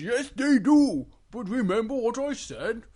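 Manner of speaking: agree, then remind firmly with a lilt; Big Bear cautioning. Acted. Big Bear reminds Little Bear of the warning, cautioning.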